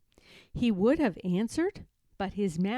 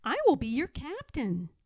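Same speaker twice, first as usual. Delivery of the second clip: proud little captain. Acted. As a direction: announce it like a bold young captain.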